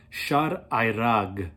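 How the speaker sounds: ordinary talk, nothing marked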